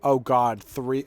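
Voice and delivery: ordinary talk, nothing marked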